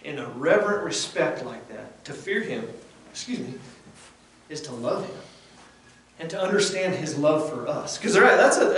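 usual speaking voice

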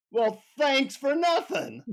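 high, drawn-out sarcasm; sarcastic. Retold or imitated. Pissed-off traveler answers the guru, sarcastically. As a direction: stretch it out with mock thanks. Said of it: "like, sarcastically say".

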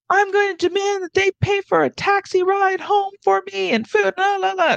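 high, mocking whiny voice; mocking entitled guy. A traveler mimics a demanding man at the desk, high mocking whine.

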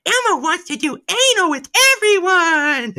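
very high, loud puppet voice; a squealing, slowed-down Elmo. Retold or imitated. Joking in town as Elmo, very high and loud. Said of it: "be like".

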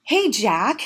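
ordinary talk, nothing marked

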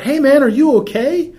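high, loud call down the street; shout. Retold or imitated. Driver calling to a skateboarder in the dark, loud shout. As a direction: call out to check he's all right. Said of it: "So I shout".

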